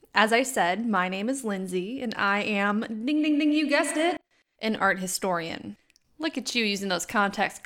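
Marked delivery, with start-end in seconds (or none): 2.8-3.5 high bright ding ding ding; game show ding. Pretend. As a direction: chime like a quiz buzzer. Game-show bell imitation.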